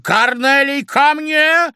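loud, high commanding call; bellowed military order; commander's shout. A narrator's reading. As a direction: summon him like a drill commander. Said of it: "крикнула ему командирским голосом".

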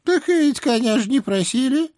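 put-on high nagging old hag; old witch voice. A narrator's reading. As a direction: scold like a fussy old witch. Baba Yaga voiced by reader.

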